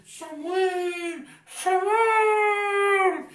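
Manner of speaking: rising into a loud, ringing call; God calling Samuel. Acted. Teacher acting God calling Samuel in the night, loud ringing call. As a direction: call him like a voice from heaven. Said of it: "y le habló así".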